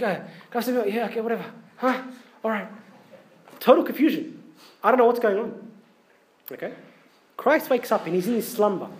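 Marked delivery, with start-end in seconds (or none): 0.0-2.7 sleepy dad with daughter. Retold or imitated. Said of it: "Chloe's like".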